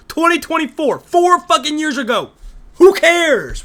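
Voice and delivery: high, rising exasperated rant; exasperated shout. Real, not acted. Viewer objecting to old election questions, exasperated shout. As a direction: build to a loud, high outburst. Ranting at irrelevant debate question.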